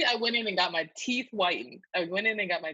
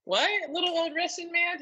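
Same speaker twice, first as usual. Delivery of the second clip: high-pitched mock astonishment; incredulous; 12 semitones higher than usual. Retelling surprise at the dentist's instant offer, incredulous. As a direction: question it in high, amused disbelief.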